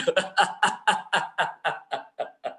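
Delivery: long laugh tailing off; hearty laugh. Real, not acted. Teacher reads out the answer "he's mad", laughing. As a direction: crack up at what was just read out.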